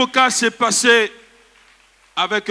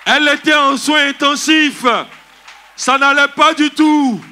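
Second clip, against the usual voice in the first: raised, louder preacher's voice; preaching proclamation; 7 semitones higher, 5 dB louder than usual. Acted. Preacher tells congregation of the intensive-care miracle, proclaiming. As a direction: proclaim it high and strong to the church.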